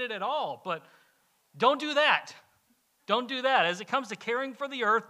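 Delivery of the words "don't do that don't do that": loud, higher, emphatic repeat; firm warning. Real, not acted. Pastor warning listeners against littering, loud and firm. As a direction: hammer the command home.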